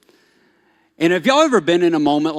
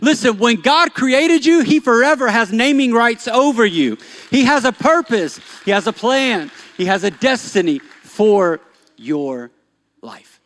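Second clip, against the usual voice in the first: loud, high, driving preacher voice; fiery sermon delivery; full-force preaching; 10 semitones higher, 7 dB louder than usual. Acted. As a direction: preach it out to the crowd.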